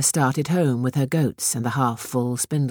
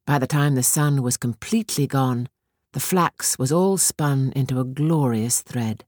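ordinary delivery, nothing marked

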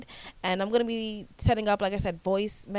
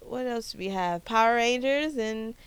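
ordinary talk

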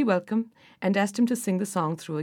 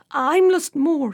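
slow, awed, higher and louder; fearful awe; 7 semitones higher, 6 dB louder, much slower than usual. A narrator's reading. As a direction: say your name slowly, in awe. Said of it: "he said in awe".

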